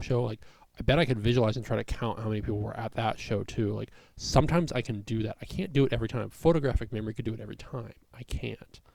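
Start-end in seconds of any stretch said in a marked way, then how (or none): none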